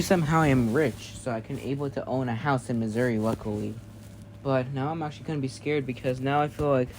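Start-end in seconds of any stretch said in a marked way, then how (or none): none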